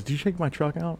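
lightly voiced dad, probing; retold father asking about the truck, mildly suspicious; dad's question. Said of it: "He goes".

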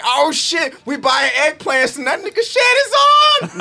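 high, slow hyped shout; excited fan voice. Retold or imitated. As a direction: play a starstruck shopper yelling. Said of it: "They're like".

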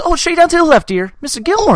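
high, loud movie-character voice; exaggerated high-pitched quoting; movie character impression. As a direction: call it out like a hammed-up film quote. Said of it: "he goes".

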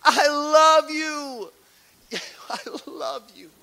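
high, slow, swelling declaration of love; God's voice, loving. Acted. God calling out to his people, loud and tender. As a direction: soar up and stretch each I love you. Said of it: "He says".

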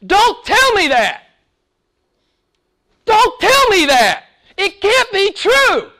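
high, slow, anguished protest; John's exclamation. Retold or imitated. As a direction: plead like John refusing to believe it. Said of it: "this is the exclamation of John".

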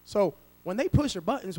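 normal talking voice

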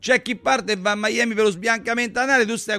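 indignant retort. Real, not acted. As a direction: bristle at the hair question and hit back. Host quizzed on his hair.

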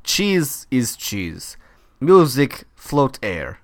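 slow mock Russian accent, lightly put on. Cohost mocks the rambling owner in a Russian accent. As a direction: drawl nonsense words in a loose Russian accent. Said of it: "I'm doing Russian. This is not Italian".

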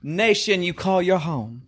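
slow mock Southern drawl; Southern accent. Host finishing a scolding, slow mock Southern accent. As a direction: draw it out, haughty Southern put-on. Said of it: "supposed to be doing accents".

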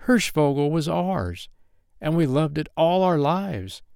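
put-on high, pleading little-boy voice; high-pitched, heartfelt appeal; trembling child. A narrator's reading. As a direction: beg earnestly as a small child. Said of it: "he said with a trembling voice".

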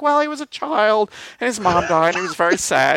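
mock the lazy backstory with a sing-song lilt; mock storyteller. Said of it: "Darth Vader is like".

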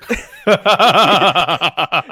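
long, loud burst of laughter; helpless rolling laugh; loud belly laugh. Real, not acted. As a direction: crack up loudly. Laughing at own Millwall joke.

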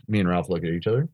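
normal talking voice

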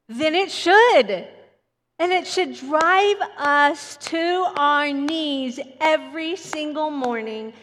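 preaching emphatically. Real, not acted. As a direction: hammer the point home to the congregation. Preacher drives point home.

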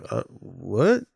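puzzled "uh, what?" with a rise; mock bewilderment; imitated confusion. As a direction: play a baffled listener, voice lifting at the end. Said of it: "people would be like".

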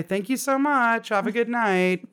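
high sing-out polite goodbye; voice going up. Retold or imitated. Customer saying good night to the store worker, voice going up. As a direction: pitch it way up, drawn-out and over-polite. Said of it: "With my voice going up like that".

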